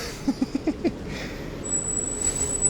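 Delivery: airy burst of laughter; breathy laugh. Real, not acted. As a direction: laugh it out under your breath.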